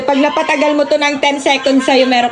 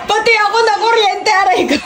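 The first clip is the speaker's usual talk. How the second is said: high laughing yell; laughing shout; 10 semitones higher than usual. Real, not acted. Shocked again, yelling.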